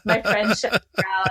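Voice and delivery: normal talking voice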